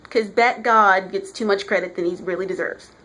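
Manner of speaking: usual speaking voice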